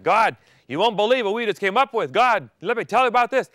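high, loud, eager boasting to God; bursting to tell God their idea; excited Adam and Eve. Pretend. Adam and Eve excitedly pitching sex to God.

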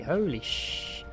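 ordinary talk, nothing marked

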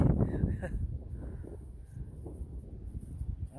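a little laugh to himself. Real, not acted. Amused at goofy dome.

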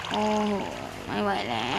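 very low, soft, worn-out voice; deep exhausted groan. Acted. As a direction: drag it out like you're spent. (เสียงทุ้มต่ำหมดแรง)

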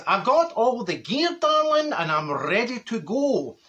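slow, sing-song camp voice rising high on darling; hammed-up luvvie neighbour, drawn out. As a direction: neighbour showing off his foil blanket, camp and slow. Neighbour quoted, proud of gear.

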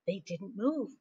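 ordinary delivery

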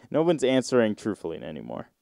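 ordinary talk, nothing marked

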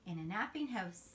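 put-on sing-song storybook read-aloud for little kids; reading to children. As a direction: read it like a bedtime picture book, rising on each new animal. Reads picture book to children.

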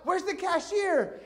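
high, drawn-out mock yell; retold yell. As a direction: imitate calling out across a shop. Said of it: "she yells out".

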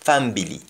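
Family said fam-bi-li; Jamaican family. Shown as a demonstration. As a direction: slip a b into Family. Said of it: "pronounced as family".

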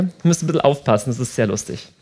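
normal talking voice

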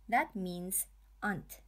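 ordinary talk

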